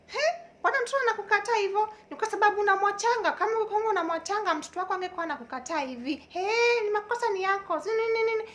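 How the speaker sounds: very high, loud, rapid-fire scolding; ranting church mama. Retold or imitated. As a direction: imitate church aunties piling on blame. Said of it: "They were just ranting".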